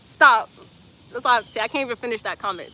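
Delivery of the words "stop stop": sharp, loud stop. Real, not acted. Streamer halting a cheeky commenter, loud.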